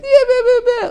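put-on very high, loud baby babbling; baby babble. Imitating a baby for doting parents, high loud babble. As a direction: squeal it out like an infant.

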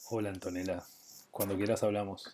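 low, soft seductive voice. Shown as a demonstration. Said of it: "tengo una voz seductora".

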